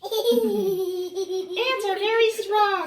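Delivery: high, slow, loud, laughing bug; high bug boast. Acted. Reading a bedtime story, the bug boasts high and laughing. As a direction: boast as a tiny bug through giggles. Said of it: "said the smelly little bug".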